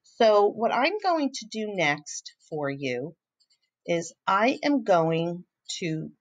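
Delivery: usual speaking voice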